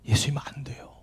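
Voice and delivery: hushed whispered plea, imitating a weeping child; 숨죽인 애원. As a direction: whisper it softly, as if stifling sobs. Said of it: "조용히 자기 입을 틀어막으면서". (입을 막은 듯 속삭이는 애원)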